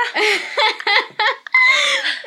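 loud, high belly laugh; high-pitched real cackling laughter; real burst of laughter. Real, not acted. Laughs at father's fart habit.

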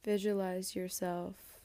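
usual speaking voice

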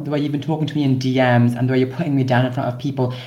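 ordinary talk, nothing marked

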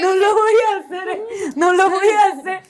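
words breaking into laughter, pitch up; laughing while talking. Real, not acted. Promising the host she won't spill the secret, laughing. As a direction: try to talk through your own giggles.

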